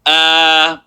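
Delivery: "uh" held long on a level note; stretched vowel. Shown as a demonstration. Teacher showing students a stretched a, long-held "uh". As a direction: draw out "uh" as one sustained sound. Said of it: "stretch it out".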